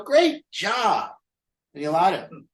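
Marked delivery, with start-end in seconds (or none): 0.0-1.1 "Great job" pitched much higher, a hollow cheer; mock upbeat praise. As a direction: fake parental enthusiasm, higher. Parent praises kid in car.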